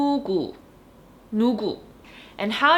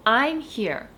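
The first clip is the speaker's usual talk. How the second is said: ordinary talk, nothing marked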